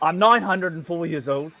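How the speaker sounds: English accent, still in character as the Time Lord; lightly done English accent of the Doctor; the Doctor's English accent. Shown as a demonstration. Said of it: "I'd like to do my Doctor Who accent for you".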